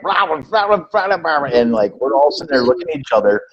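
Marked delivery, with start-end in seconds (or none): 0.0-1.5 put-on high, garbled nonsense mumble of an old drunk; drunk old man. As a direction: mimic an old drunk's babble you can't make out. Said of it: "this old guy came up and was just like".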